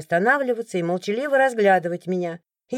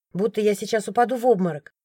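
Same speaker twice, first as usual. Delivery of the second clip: ordinary delivery, nothing marked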